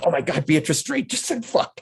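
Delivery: high, scandalised retelling; mock shock. Retelling shock at an actress swearing on set, high-pitched disbelief. Acts out own shocked reaction.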